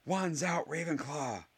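high-pitched, restrained-volume battle cry; shout. A narrator's reading. Michael Corner joins the disaster, high shout. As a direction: call out high, as if from afar. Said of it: "shouted Michael Corner".

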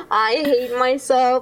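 ordinary delivery

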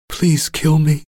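whimpered plea. Acted. Broken captive begs his captor, high whimpered plea. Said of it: "I whimpered".

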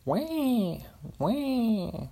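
very high squealing wah over and over; mock eagle scream. Guessing aloud what a screaming eagle sounds like, very high mock screech. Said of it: "a screaming eagle would sound like".

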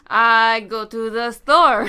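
slow, drawn-out mock French accent; imitated French accent. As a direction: lightly mimic a bad French accent. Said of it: "He sounded French to me".

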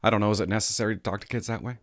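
usual speaking voice

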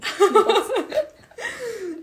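big high laugh; loud laugh. Real, not acted. Friend interrupts mid-sentence, laughs.

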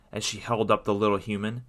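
plain reading voice

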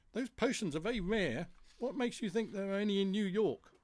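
lightly voiced bee, questioning the plan; sceptical bee character. Beatrice doubts the potion plan.

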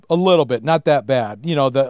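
ordinary talk, nothing marked

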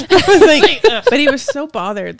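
laughing hard while telling it. Real, not acted. Mom laughing as she tells of her bothered son, laughing. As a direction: laugh through the words.

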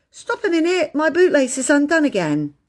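put-on high-pitched child's complaint; calling to wait. A narrator's reading. Phyllis halting the others over her bootlace, complaining. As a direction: call out high for them to stop.

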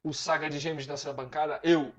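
grand introduction, drawing out the name; dramatic announcer intro. Acted. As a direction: build up each name like a show host.

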